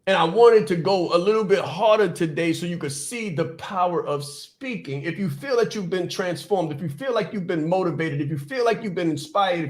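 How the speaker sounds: ordinary talk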